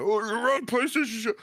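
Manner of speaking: high-pitched imitation of yelling complainers; mock yelling. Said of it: "everybody yelling".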